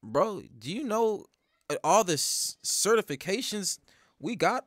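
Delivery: soft, measured, halting questioning; lightly acted challenge. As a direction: play a quietly confident fighter. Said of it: "Igor's like".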